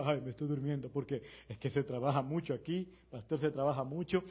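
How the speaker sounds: lightly mock the tired church worker; mock complaining worker. Pastor mocking members who complain of tiredness, mild whiny voice. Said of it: "Ahora hay una gritería".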